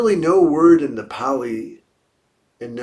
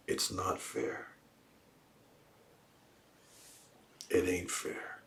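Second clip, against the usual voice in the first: slow, hushed, low grumble; low muttered complaint; 5 semitones lower, 16 dB quieter than usual. Retold or imitated. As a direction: mutter it under your breath, low and slow. Voicing people's complaint of unfairness.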